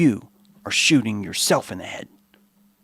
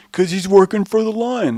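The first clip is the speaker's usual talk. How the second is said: higher, louder mock accusation; high mocking accuser; 9 semitones higher, 4 dB louder than usual. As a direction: repeat it high and jeering. Skeptics accuse giraffe, repeated.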